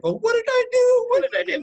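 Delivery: very high, loud, squeaky mock panic; whiny bewildered friend. Friends mocking Treadaway's confused reply to an angry text, high squeal. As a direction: squeal it like a hurt, baffled friend. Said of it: "Treadaway's texting back".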